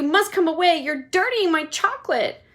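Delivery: high, loud fretful plea; fretting Wonka. Acted. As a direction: hop about in alarm. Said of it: "cried Mister Wonka".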